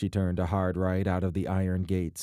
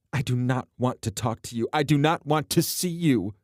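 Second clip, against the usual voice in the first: rising anger; 5 semitones higher than usual. Acted. Alana refuses, rising.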